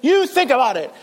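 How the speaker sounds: high, loud telling-off from an exasperated parent; imitated parent laying down the law; scolding parent. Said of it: "like what your parents see".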